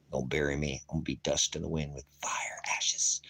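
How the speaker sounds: hushed, breathy whisper; whispered aside. Real, not acted. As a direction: drop to a whisper about turning to ash. Dramatic aside about his own death.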